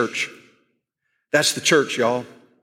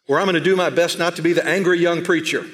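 ordinary talk

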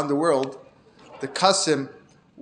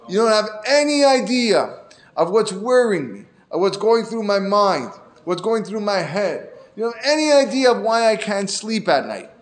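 put-on higher, insistent complaint from a drunk friend; pleading outburst; 6 semitones higher, 3 dB louder than usual. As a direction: pour out your worries, pressing him. Drunk villager pours out troubles.

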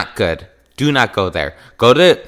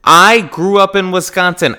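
gushing; 9 semitones higher, 5 dB louder than usual. Acted. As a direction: swoon over the word cheese. Exaggerated cheese love.

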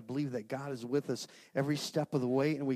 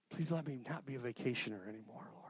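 hushed, breathy plea to God; whispered prayer; 10 dB quieter, faster than usual. Real, not acted. Preacher whispering a plea to God in prayer. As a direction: whisper the prayer, barely voiced.

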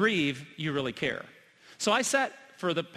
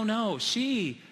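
ordinary talk

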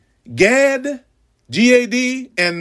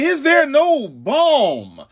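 higher, pleading cry rising to a shout; anguished prophet's cry; 11 semitones higher than usual. Acted. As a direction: cry out to heaven like a grieving prophet. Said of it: "And I say".

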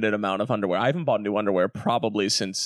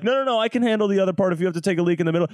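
fast, higher-pitched mimicry of the co-host; teasing quote, rushed and pitched up; mimicked eagerness; 7 semitones higher, much faster than usual. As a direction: rattle it off as a mock eager volunteer. Mimics co-host's eager offer.

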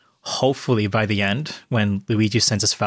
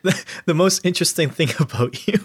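laughing; 8 semitones higher than usual. Real, not acted. Teasing friend while laughing.

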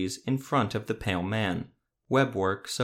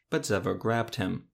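usual speaking voice

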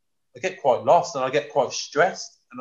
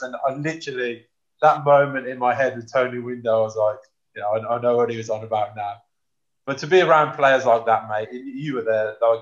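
normal talking voice

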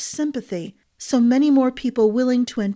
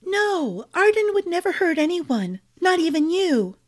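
higher, earnest and pleading; pleading wife voice; 8 semitones higher than usual. A narrator's reading. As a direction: defend her friend tearfully. Wife defends her friend.

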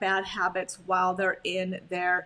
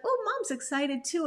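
lightly voiced dog, pitched higher; excited dog's voice; 6 semitones higher than usual. Dog noticing mom's excitement, high excited voice. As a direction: pipe up high as an excited puppy. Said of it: "'cause they're like".

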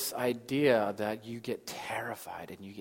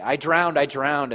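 loud, raised mock cry; retold child's panicked cry; 4 semitones higher, 8 dB louder, slower than usual. Us waking before God, mock-panicked cry. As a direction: blurt it like a child coming up from underwater. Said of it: "it will be like a child dipping in the pool".

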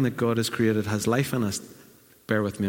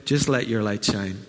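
usual speaking voice

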